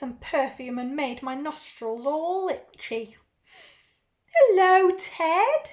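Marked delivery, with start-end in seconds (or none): 4.4-5.7 loud, high, gushing aunt; big, high-pitched auntie greeting; effusive aunt. Acted. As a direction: greet the nephew loudly, pitched up.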